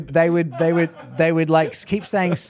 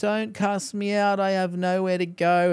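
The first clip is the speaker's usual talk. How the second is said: imitated pleading; 4 semitones higher, slower than usual. Demon with nowhere to go, imitated pleading. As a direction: beg as the demon, drawing the words out. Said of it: "a few pleading with me".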